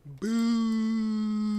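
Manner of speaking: loud high mock boo. Real, not acted. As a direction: boo it like a heckler. Booing the live-action news.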